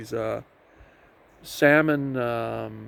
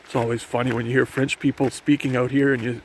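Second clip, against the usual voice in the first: normal talking voice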